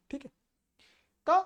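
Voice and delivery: ordinary talk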